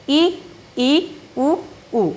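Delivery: ई and उ voiced with a barely open mouth; vowel demo. Shown as a demonstration. Teacher shows students how little the mouth opens. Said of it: "आप करके देखिए".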